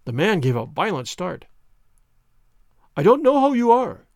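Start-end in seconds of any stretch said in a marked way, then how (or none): 3.0-4.0 high, loud startled outburst; a man crying out in alarm; agitated cry. Acted. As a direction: blurt it out, shaken and loud. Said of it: "he cried".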